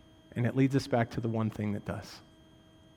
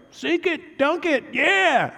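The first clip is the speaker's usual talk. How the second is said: mock Jesus cheering; 13 semitones higher, 5 dB louder, much slower than usual. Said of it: "Jesus was like".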